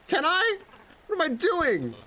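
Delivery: very high, doubting character voice; hammed-up high-pitched self-doubt; panicking climber. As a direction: squeak it out in mock panic. Panicking climber answers calm helper.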